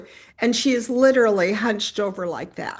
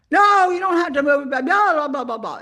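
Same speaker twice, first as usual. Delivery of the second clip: high, loud ranting that tumbles into blahs; mock yelling; 6 semitones higher, 5 dB louder than usual. Upset woman cuts off the newcomer's apology, mock yelling. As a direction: imitate someone yelling over an apology. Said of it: "she's busy yelling at me".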